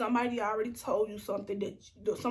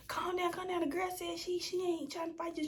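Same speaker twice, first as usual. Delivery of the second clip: high mock friend voice; 8 semitones higher than usual. Imitating friend calming her down.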